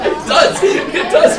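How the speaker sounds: loud laughing agreement. Real, not acted. Teacher amused at the vacuum cleaner joke, laughing. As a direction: talk through real laughter.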